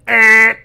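loud, high mock buzzer "Eh!"; buzzer noise. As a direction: blast a game-show wrong-answer buzz. Buzzer noise rejecting the claim.

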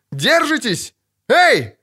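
high, frantic holler; крик. A narrator's reading. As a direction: shout encouragement down from above. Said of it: "крикнул сверху Лютик". (крикнуть сверху высоким голосом)

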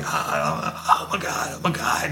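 high, breathless whispered panic; mock panicked gasping. Suspect panicking as the pepper spray takes hold, gasping. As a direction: gasp it out as the burning sets in.

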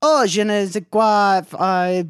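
high, loud, slow mock French; French accent. Imitating snooty French locals, hammy French accent. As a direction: exaggerate a drawn-out French flourish. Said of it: "I also have my French accent".

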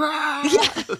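high-pitched imitated yell; mock yell. Host imitating Terry coming out of the water, mock yell. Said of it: "Terry just coming out of the water and just going".

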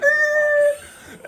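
high, sharp cry of alarm; imitated shocked shout; mock startled yell. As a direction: yelp like a driver caught off guard. Startled driver hit by purse.

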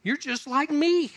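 high, slow, sceptical "how?"; skeptical onlooker. Retold or imitated. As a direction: raise the voice high and doubt him. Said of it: "they're looking at you going".

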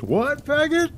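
high-pitched imitation of an offended man; mock outrage. Retelling the singer snapping at a teenager, high mock outrage.

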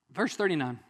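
ordinary talk, nothing marked

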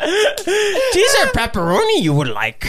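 slow, high, strained creature voice; Yoda. Acted. Friend correcting the bad impression with pizza order, slow Yoda voice. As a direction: draw it out like a puppet sage, high and odd. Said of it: "That sounded like Cookie Monster".

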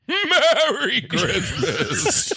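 laughing. Acted. Santa exits laughing.

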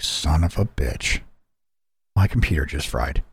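low, quiet mock dismay; deflated realization. Gamer spots the red dot on card, low deflated curse.

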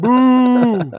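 loud mock boo at a joke. Real, not acted. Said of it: "That was a bad joke".

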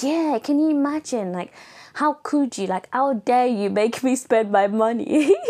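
high, mock-indignant outrage; hammed-up diva indignation. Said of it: "I'm like".

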